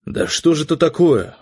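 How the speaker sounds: mild exasperated indignation; indignant. A narrator's reading. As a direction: protest at being misunderstood. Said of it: "возмутился я".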